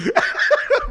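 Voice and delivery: loud high cackle; loud high laugh. Real, not acted. Laughing hard at his friend's club joke, loud laugh.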